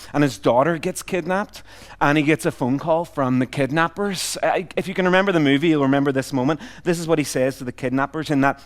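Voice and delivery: usual speaking voice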